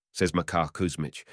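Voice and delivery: plain reading voice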